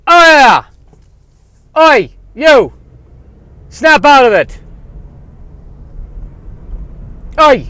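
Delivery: high, loud Irish paramedic voice; brash Irish paramedic; Irish accent. Acted. [name] character voice.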